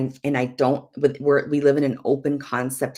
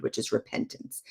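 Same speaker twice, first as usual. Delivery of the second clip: ordinary delivery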